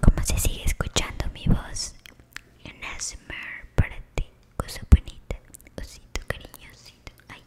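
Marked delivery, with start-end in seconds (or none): none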